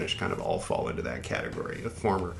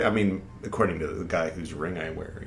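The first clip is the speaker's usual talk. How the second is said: ordinary talk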